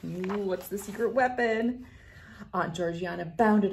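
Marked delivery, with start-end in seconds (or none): none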